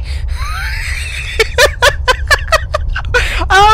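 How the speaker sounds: long, high helpless laugh; real peal of laughter; laughing fit. Real, not acted. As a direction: burst out laughing after a scare. Laughs after second scare.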